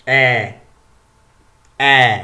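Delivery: "Eh" said loud as an open French è, as in "les infirmières"; loud, careful classroom "Eh". Shown as a demonstration. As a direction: model the open "Eh" for the learner. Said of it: "nous avons trois types de E".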